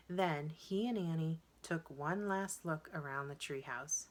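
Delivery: ordinary delivery, nothing marked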